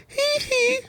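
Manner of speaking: very high falsetto giggle; kids mocking. Schoolkids laughing at his hair, high falsetto titter. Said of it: "I heard".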